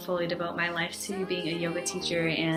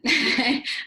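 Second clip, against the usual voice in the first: brief higher-pitched chuckle; short laugh; 6 semitones higher, 6 dB louder than usual. Real, not acted. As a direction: let out a quick laugh mid-thought. Laughs while recalling her expectations.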